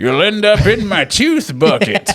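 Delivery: high put-on creepy voice; creepy villain voice. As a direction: cackle it like a fairy-tale villain. Voicing scary dentist.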